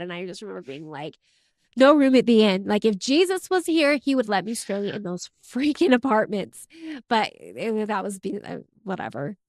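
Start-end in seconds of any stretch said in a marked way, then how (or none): 1.8-6.7 loud, indignant rant; retold angry outburst. Angry rant about the temple president rejecting them, loud. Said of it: "And I was so mad".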